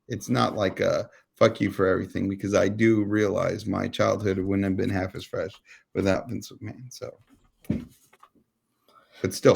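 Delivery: ordinary talk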